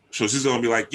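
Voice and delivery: normal talking voice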